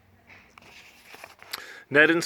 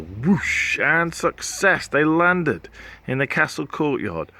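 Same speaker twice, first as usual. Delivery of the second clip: ordinary delivery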